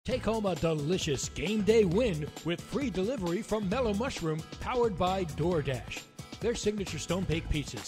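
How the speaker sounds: upbeat ad read; home shopping host. Acted. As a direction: sell it like a commercial. Mellow Mushroom ad read.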